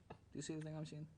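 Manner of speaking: ordinary talk, nothing marked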